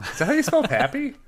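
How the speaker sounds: high-pitched disbelieving question; incredulous teasing. Real, not acted. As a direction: question the spelling, voice leaping up. Teasing incredulously about spelling.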